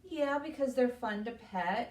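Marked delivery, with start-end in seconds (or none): none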